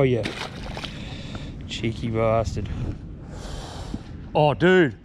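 usual speaking voice

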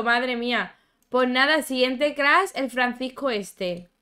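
ordinary delivery

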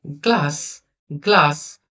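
গ্লাস repeated with a clear final s; pronunciation demo. Shown as a demonstration. Teacher letting pupils hear the s, pronunciation demo of গ্লাস. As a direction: model গ্লাস word by word, hissed s at the end. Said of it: "অ্যাশের উচ্চারণটা".

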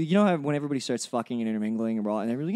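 normal talking voice

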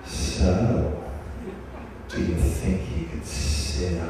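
low, taunting serpent voice, far below her own; provocative snake voice. Retold or imitated. Anaconda taunting the speaker during the ceremony, low and provocative. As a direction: tease her like a sly giant anaconda. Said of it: "in this really provocative, kind of weird voice".

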